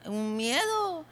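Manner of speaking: normal talking voice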